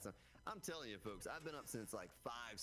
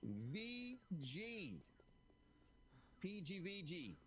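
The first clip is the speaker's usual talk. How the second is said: a hint of a French accent, pitched higher; 10 semitones higher, much slower than usual. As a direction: try on a bit of a French accent. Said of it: "give that accent a try".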